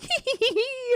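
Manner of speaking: high squeaky hee hee cackle; a tiny creature's gleeful tittering; shrill-ish little goblin laugh; goblin giggle. Retold or imitated. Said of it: "he's like".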